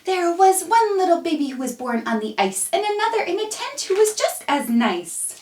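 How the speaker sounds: lively singsong storybook voice; reading to children. Acted. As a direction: read it brightly to a little one. Reads a rhyme to a baby.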